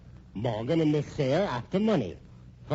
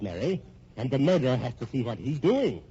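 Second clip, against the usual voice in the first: ordinary delivery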